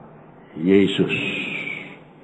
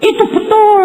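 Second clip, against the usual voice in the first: high bright-eyed "logis, itu betul"; mock agreeing exclamation. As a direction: chirp it up high like a naive yes. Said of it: "Jadi aku bilang".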